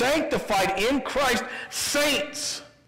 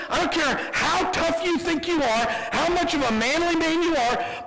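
ordinary talk

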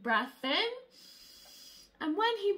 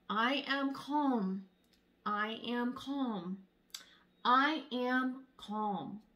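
calm mantra; 3 semitones lower, much slower than usual. A narrator's reading. Sunny calming her frustration, very slow. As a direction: let each word settle slowly. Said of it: "and tells herself".

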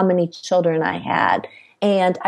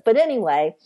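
ordinary talk, nothing marked

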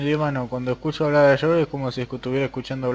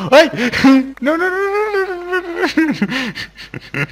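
panicked; 8 dB louder, much slower than usual. Real, not acted. Panic in the game.

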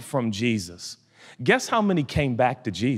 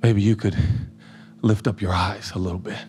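tender voice of God; 7 semitones lower than usual. Pretend. Voicing God's gentle reply.

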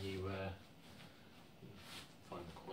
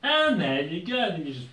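mock triumphant; 6 semitones higher, 7 dB louder than usual. Tutorial reveal.

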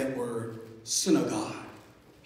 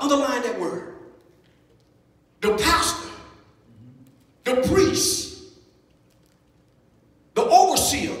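raised, higher-pitched sermon delivery; emphatic preaching; 7 semitones higher, 6 dB louder than usual. Real, not acted. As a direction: preach it in short, forceful phrases with pauses between. Preaches on the scripture with force.

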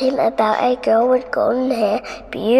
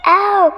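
sharp high yelp of pain; pained cry; 8 semitones higher, 5 dB louder than usual. A narrator's reading. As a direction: yelp as the hair is yanked. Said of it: "cried Rapunzel".

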